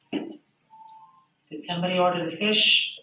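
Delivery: put-on high, slow elderly halfling; lightly voiced aged server; old halfling woman. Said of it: "older female halfling, very old".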